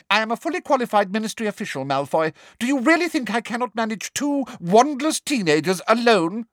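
high, fast sharp retort. Acted. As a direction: snap back sharply. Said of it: "asked Umbridge sharply".

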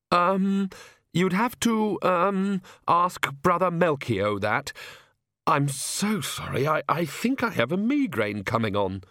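put-on timid, apologetic young priest, stumbling over words; fretful clergyman who can't get a sentence out; nervous stammering priest. Priest Oates speaks.

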